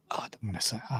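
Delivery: very soft, near-whispered aside; reluctant murmur. Real, not acted. Streamer avoiding a touchy subject, reluctant murmur. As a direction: back away from it under your breath.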